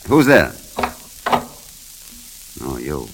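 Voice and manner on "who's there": sharp call. Acted. Startled by an intruder.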